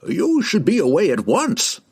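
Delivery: put-on high-pitched, urgent old scholar; old herald advising. A narrator's reading. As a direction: advise them to leave without delay.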